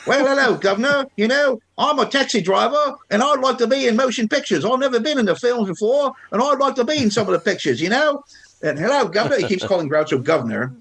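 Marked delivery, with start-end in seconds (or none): 0.1-9.4 high, hammed-up Cockney taxi driver voice; outrageous Cockney accent. Comic actor on a quiz show, in hammed-up Cockney. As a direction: lay the Cockney on thick, pitched well up. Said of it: "sporting this outrageous Cockney accent".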